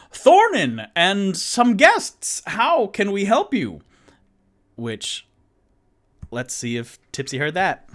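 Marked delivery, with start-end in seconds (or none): 0.1-3.8 high, loud, slow hail across the street; very loud call. Acted. Toot Toot calling loudly to alert Tipsy, loud and high. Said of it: "will say very loudly".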